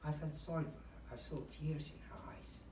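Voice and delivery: gently sad, almost whispered; sad demo. Shown as a demonstration. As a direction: let it sink, quiet and sorrowful. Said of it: "describe a sad situation".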